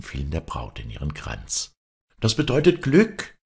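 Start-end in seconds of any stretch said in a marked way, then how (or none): 2.2-3.4 high, loud well-wishers' cry; calling out. A narrator's reading. Onlookers in the churchyard to bride, calling out high. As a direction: call it out brightly, pitched high. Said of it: "riefen die Leute".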